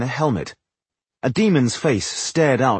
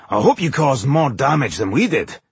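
raised, animated dwarf voice; excited; 4 semitones higher than usual. A narrator's reading. Dwarf hopes the woman hurt their enemy, excited. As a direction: push on eagerly in a put-on voice. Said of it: "Boindil said excitedly".

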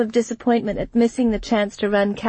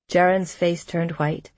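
usual speaking voice

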